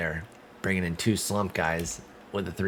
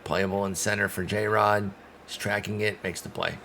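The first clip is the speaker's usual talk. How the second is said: normal talking voice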